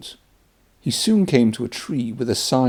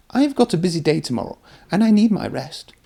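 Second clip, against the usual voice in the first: fast, higher nervous excuse; hurried excuse; 9 semitones higher, faster than usual. Acted. Thomas making excuses to avoid the old line, fast nervous high excuse. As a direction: gabble it out to get away.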